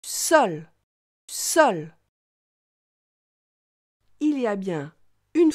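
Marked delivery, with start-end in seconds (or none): 0.0-2.0 "Sol" said alone, final L sounded out; clear final L. Shown as a demonstration. Teacher asking the child to count L sounds, careful articulation. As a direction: isolate each Sol and let its L ring. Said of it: "Combien de fois entends-tu le son L".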